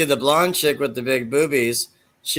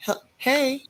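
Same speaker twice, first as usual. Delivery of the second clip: high, softer, hesitant hey; a timid stammered hey; girl cadet; 12 semitones higher, 5 dB quieter than usual. Retold or imitated. Girl beside Bobcat speaking up, high soft hesitant. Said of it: "the girl that was next to Bobcat said".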